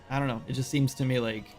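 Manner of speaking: ordinary talk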